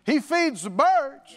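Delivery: high, loud, slow preacher's proclamation; emphatic faith confession; bold declaration. Shown as a demonstration. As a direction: declare it out loud with full conviction. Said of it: "Now you start talking like that".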